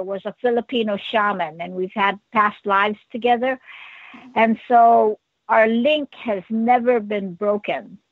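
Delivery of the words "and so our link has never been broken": ordinary talk, nothing marked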